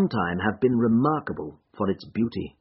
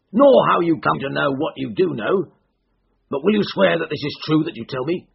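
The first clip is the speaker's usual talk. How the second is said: raised, higher voice pressing for truth; agitated cry; 6 semitones higher, 5 dB louder than usual. A narrator's reading. Startled man demands the visitor swear it's true, agitated. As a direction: cry out, then press the question. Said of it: "he cried".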